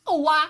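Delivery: high, loud cartoon wail; mock blast-off cry. Host mimicking a scorpion launched into the sky, mock wail. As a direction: cry out like a villain flung skyward.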